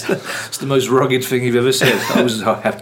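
loud, laughing tease. Real, not acted. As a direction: laugh through the jab at your co-host. Teasing co-host, laughing.

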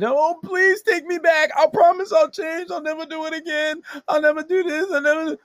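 hammed-up crying, begging to be taken back; mock tearful begging. Man begging his ex to take him back, mock crying. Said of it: "begging and crying".

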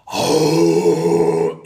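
low, loud, sustained bear bellow; imitated grizzly roar. Camper retells a massive grizzly coming up, imitated deep loud roar. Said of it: "it's the loudest thing I've ever heard".

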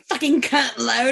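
loud mock-British insult; bad British accent. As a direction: imitate a terrible British accent, loudly. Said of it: "super bad British accent".

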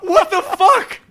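high shocked outburst. Real, not acted. Reacting to shocking video.

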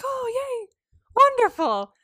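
high, singsong delight; hammed-up motherly cheer; mock delighted mother. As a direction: gush in a high, put-on voice. Mother's reply to happy news.

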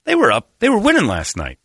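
building up over the game; excited. Real, not acted. Telling co-hosts how the Bears game went, excited. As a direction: voice jumps up with excitement. Excited sports rant, big pitch jump.

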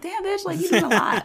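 high, mock-judging reaction; sassy disbelief. Viewer judging an over-the-top character, sassy. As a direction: tease her in a high, sassy voice.

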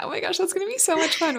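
high, quick rush of excitement; excited gushing. Real, not acted. Friend hears the roommates want to hang out, excited gushing. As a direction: gush it fast and high. Thrilled about friend's weekend plans.

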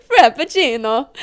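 put-on Italian accent, rising at the end. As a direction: roll it out like an Italian waiter. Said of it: "in an Italian accent".

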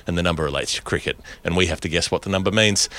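usual speaking voice

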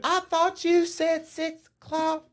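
high, slow put-on protest. [name] answering back in the yard fight, high mock protest.

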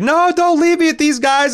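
high, loud pleading wail; imitated cry of anguish. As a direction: mock-desperate plea not to be left. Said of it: "genuine cry of anguish".

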